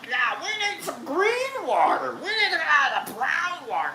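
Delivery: high, put-on grumbling voice; mock an older companion's fussing in a high voice; mimicked complaint. Storyteller mimics Pops wanting green water, exaggerated high complaint.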